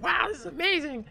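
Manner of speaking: very high, loud, giddy squeal; amazed gushing. Retold or imitated. Teen boy at the cheerleaders' table, gushing amazed. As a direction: gush like the spray is working magic. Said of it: "and I'm like".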